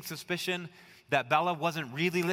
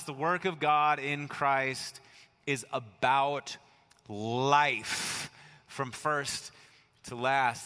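slow emphasis; much slower than usual. Acted.

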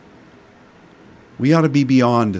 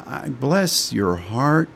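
Southern bless your heart; 4 dB quieter, slower than usual. Shown as a demonstration. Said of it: "That's a Southern phrase of saying".

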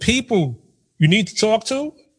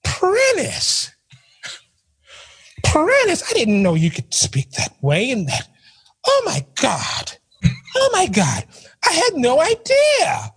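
much higher, amazed gasping voice; 10 semitones higher, slower than usual. Retold or imitated. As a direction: play the stunned coworker, high-pitched. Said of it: "He was like".

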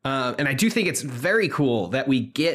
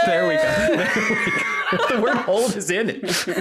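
high laughing shout; 13 semitones higher, much slower than usual. Real, not acted. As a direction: crack up while talking. Bursting into laughter at pun.

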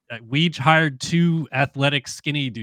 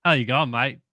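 Australian twang, kept restrained; American's careful Australian accent; Aussie accent. Shown as a demonstration. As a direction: do your best Aussie without overdoing it. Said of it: "give me your best Aussie accent".

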